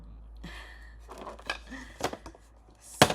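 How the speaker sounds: breathy laugh. Real, not acted. Host after an emotional reading, airy relieved laugh. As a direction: let out a release laugh.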